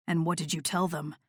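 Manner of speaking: low and soft, teasing; low soft male voice. Acted. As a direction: ask it low and quiet. Noah low.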